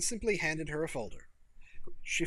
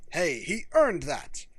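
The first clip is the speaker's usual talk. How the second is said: mock quote; 3 semitones higher, 8 dB louder, slower than usual. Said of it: "you can just say".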